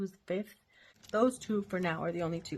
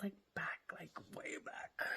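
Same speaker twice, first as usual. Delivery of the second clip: whisper; 2 semitones lower, 13 dB quieter than usual. Real, not acted. Conspiratorial aside about old times.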